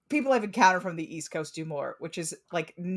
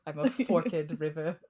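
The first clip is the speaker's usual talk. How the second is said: ordinary talk, nothing marked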